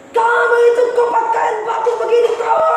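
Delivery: high, loud angry scolding; scolding boss. Retold or imitated. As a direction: yell at the servant for ruining the clothes. Boss scolding over ruined clothes.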